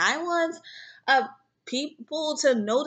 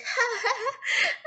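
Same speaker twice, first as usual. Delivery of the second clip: laughing; 12 semitones higher than usual. Real, not acted. Laughing at own past memories.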